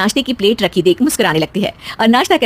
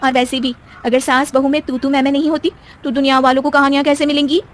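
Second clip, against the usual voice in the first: normal talking voice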